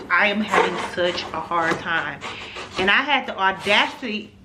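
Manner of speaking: usual speaking voice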